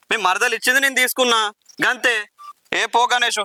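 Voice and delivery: dismissive retort. Acted. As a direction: throw it back at him, dismissive. Lender brushes off the angry debtor. (కోపంగా తోసిపుచ్చడం)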